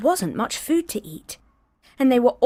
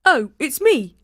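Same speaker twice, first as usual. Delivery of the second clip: higher, louder goat voice; a bigger but still young goat; middle goat; 6 semitones higher, 5 dB louder than usual. Acted. Second goat answering the troll, higher voice. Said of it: "said the second billy goat Gruff".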